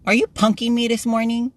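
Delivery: high-pitched, incredulous question; mock accusation. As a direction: playfully demand an answer, pitch climbing. Retold mock confrontation of coworker.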